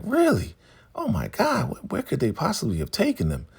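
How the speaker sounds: high-pitched, astonished mock innocence; acting unaware. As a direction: play shocked, as if you had no idea. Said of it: "acting like she doesn't know".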